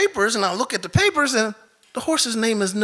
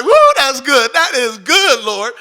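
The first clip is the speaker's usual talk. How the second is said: loud, high, laughing delight; delighted exclaim; 4 semitones higher, 6 dB louder than usual. Retold or imitated. Speaker to God about the horse's name, thrilled laughing praise. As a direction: marvel out loud at God's gift.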